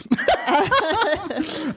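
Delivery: high shrieking cackle; screaming laugh. Real, not acted. Shrieking laugh that floods the microphones.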